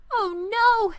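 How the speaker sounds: high cry of dismay; a boy seeing it all go wrong again; dismayed child. A narrator's reading. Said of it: "Andy said again".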